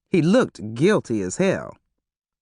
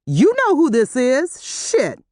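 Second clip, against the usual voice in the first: angry; 9 semitones higher, 4 dB louder, slower than usual. A narrator's reading. Terry furious at Harrison.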